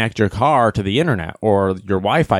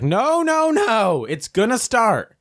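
high-pitched, mock-insistent protest; excited insistence; 10 semitones higher, slower than usual. Friend insisting to the group his car will start, excited insistence. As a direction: imitate a friend pleading for everyone to wait.

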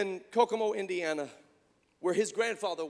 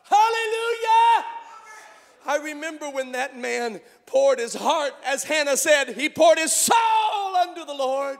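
fiery revival preaching; full-force preaching; 10 semitones higher, 9 dB louder than usual. Acted. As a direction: preach it at the top of your lungs to the congregation. Preacher erupts again.

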